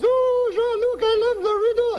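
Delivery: very high falsetto French-Canadian wife; high woman's voice. Acted. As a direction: squeal it as the uncle's adoring wife. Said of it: "She's a woman, high voice".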